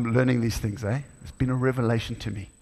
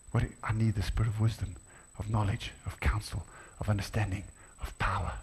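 quiet retelling; 4 semitones lower, 7 dB quieter than usual. Retold or imitated. Father son prayer dialogue retold.

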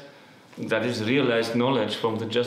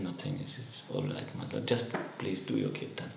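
much softer, hushed and steady; quiet calm reassurance; 3 semitones lower, 9 dB quieter than usual. Retold or imitated. Srivas Thakur hiding his grief from the Lord, hushed and calm. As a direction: hush it, nothing is wrong here.

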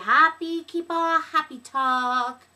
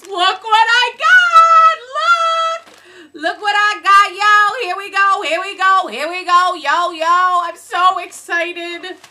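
excited puppy voice; 8 dB louder than usual. Retold or imitated. Host voices her puppy showing off the mail, loud and squeaky. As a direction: bounce in as an overexcited puppy. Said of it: "my puppy comes".

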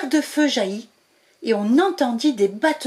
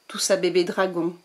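plain reading voice

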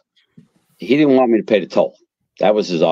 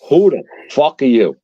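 gruff captain; 6 semitones higher than usual. Retold or imitated. Captain barks at new probie.

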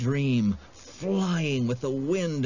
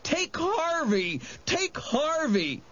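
very high, slow wailing plea; sobbing; 14 semitones higher, slower than usual. A narrator's reading. Wendell betrays his friend to save himself, sobbing. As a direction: wail it out desperately. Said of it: "he sobbed".

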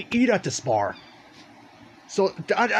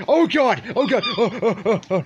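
being chased; 4 semitones higher, 3 dB louder than usual. Real, not acted. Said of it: "They're chasing after me".

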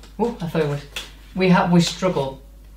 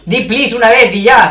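loud, fed-up mock thought; exasperated aside; harta, de broma; 4 semitones higher, 7 dB louder than usual.

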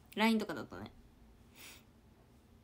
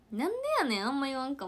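higher, louder Kansai "なんでやねん" tsukkomi; Kansai retort; 4 semitones higher, 3 dB louder, faster than usual. Shown as a demonstration. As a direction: snap it out like a Kansai comic. (関西弁で高く強めに「なんでやねん」とツッコむ)

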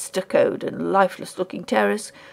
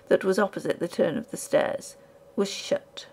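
ordinary delivery, nothing marked

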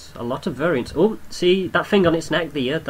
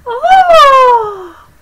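very high, loud "oh"; sudden high-pitched yelp, held long; loud whoop; 15 dB louder than usual. Real, not acted. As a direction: let out a piercing whoop. Sudden cry while playing.

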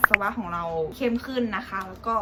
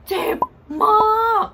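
sudden loud high cry of pain; hammed-up yell of pain; pained outcry; 12 semitones higher, 8 dB louder, much slower than usual. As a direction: blurt it out like it really hurts. Recalling sudden eye pain.

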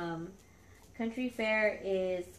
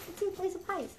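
playful to dogs; 8 semitones higher, 4 dB quieter, faster than usual. Real, not acted. Repeats line to dogs.

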